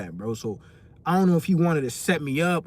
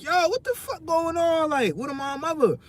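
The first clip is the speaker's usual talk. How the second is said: high, slowed disbelief; baffled outrage; 12 semitones higher than usual. Retold or imitated. Login keeps failing, baffled high-pitched complaint. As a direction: stretch it out, pitched way up in confusion. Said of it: "I'm like".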